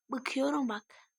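ordinary delivery

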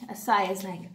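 usual speaking voice

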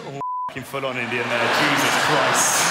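usual speaking voice

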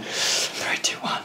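hushed three two one; whispered countdown before the song. Real, not acted. Counting down to the track.

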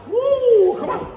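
high, loud whoop; excited whoop. Real, not acted. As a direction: whoop to pump him up. Coach hyping boxer mid-round.

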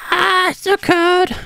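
high, slow shivering wail; freezing cry. Real, not acted.

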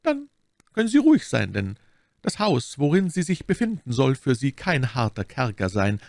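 high, kindly abbess voice; reassuring abbess. A narrator's reading. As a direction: reassure the guest in a lifted voice.

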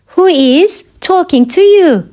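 measured language-lesson delivery. Shown as a demonstration. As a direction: pause after "Who is". Teacher repeats question slowly for learners.

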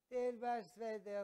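acted motherly command at full volume; insistent mother. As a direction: order it loudly, stretching each word. Mother insists over son's objections.

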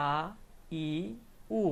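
আ, ই, উ each held alone; pronunciation demo. Shown as a demonstration. Teacher showing how alif sounds with each mark, pronunciation demo. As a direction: recite আ, ই, উ with a gap after each. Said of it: "উচ্চারণটা আমার কেমন হবে".

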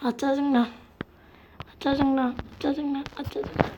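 grumbling under the breath; annoyed muttering. Real, not acted. Annoyed after losing.

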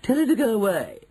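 very high, haughty woman; high-pitched outrage; indignant dismissal. Acted. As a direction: order her gone in a high, affronted voice. Mrs Jenkins dismisses grandmother.

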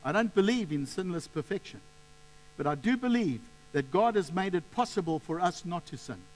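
ordinary talk

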